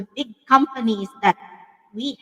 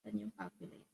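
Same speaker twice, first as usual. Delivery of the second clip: muttering to herself; 3 semitones lower, 12 dB quieter than usual. Real, not acted. Searching for calculator, muttering aside.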